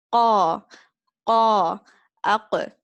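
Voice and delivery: qa loud with a wide open a, no lip movement; correct open qa. Shown as a demonstration. Teacher giving students the right qa, loud and open. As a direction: open the mouth and keep the lips still on qa. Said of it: "with the a sound".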